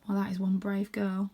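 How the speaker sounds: usual speaking voice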